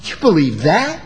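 scoffing disbelief to make them feel stupid; mocking put-down question; belittling tone. Shown as a demonstration. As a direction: sneer at the spouse's view. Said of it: "We can make them feel stupid".

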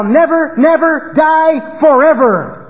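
"never, never die forever" shouted high and loud, slowing at the end; emphatic rendering of the double negative; stress on never. Shown as a demonstration. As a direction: hammer each never then stretch forever. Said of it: "would be to say".

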